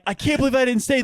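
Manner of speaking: high, anxious self-reproach; panicked high voice. Retold or imitated. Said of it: "I'm, like, freaking out".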